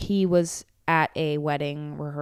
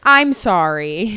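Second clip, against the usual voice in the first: stunned; 2 semitones higher, 8 dB louder, much slower than usual. Real, not acted. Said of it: "stunned apology".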